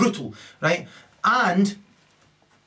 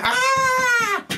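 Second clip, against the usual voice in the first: long high held "aah" scream; hammed-up high wail; mock scream. Storyteller voicing people as they flee, mock scream.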